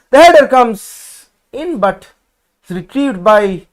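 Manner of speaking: loud, high, drawn-out call; excited play-by-play. Real, not acted. Commentator calling a set-piece header, excited and loud. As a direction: stretch the call out as the chance arrives.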